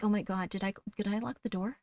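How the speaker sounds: soft, self-doubting murmur; acting out worried thoughts under the breath; quietly fretting to oneself; anxious inner thoughts. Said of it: "takes you out of your thoughts".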